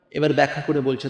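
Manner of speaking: normal talking voice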